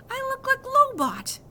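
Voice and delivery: put-on boy wailing at mom, high and loud; angry wail. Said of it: "I said angrily".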